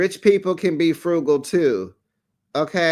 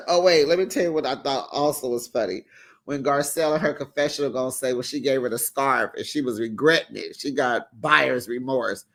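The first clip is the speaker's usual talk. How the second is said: ordinary talk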